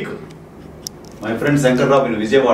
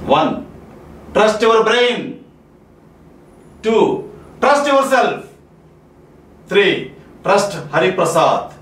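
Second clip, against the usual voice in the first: rousing uplift; 6 semitones higher than usual. Acted. Rousing closing rules.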